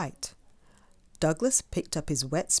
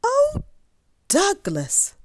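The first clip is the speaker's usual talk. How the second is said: dismayed high "oh", falling on the name; sighing mum; 7 dB louder, slower than usual. A narrator's reading. Mum seeing Douglas come home, dismayed sigh. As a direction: sigh it out, starting high and loud. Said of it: "sighed his mum".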